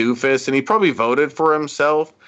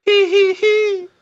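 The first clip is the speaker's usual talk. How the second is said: high squeaky falsetto hee-hee; slow, high-pitched pop-star giggle; [name] hee-hee; 4 dB louder, slower than usual. Retold or imitated. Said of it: "He's like, he's like [name]".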